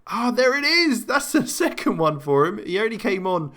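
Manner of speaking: very high burst as it goes in; real thrill at a second goal; excited goal call. Real, not acted. Goal scored during live commentary.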